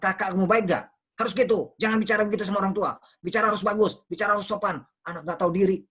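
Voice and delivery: high, loud telling-off; pushy parent rattling off reproaches; harsh parental scolding. Shown as a demonstration. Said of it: "kita bicara dengan cara yang tidak baik".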